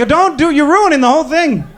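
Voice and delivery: usual speaking voice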